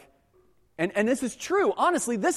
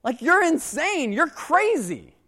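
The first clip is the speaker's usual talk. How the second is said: high, slow, incredulous scolding; a loud, raised apostle losing patience; what's wrong with you people, disbelief in the voice; exasperated Paul; 9 semitones higher, 6 dB louder, slower than usual. Retold or imitated. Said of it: "he has one overarching message".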